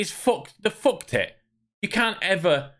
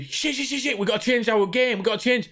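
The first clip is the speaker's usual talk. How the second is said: mock panic; 7 semitones higher than usual. Devs panicking after player feedback.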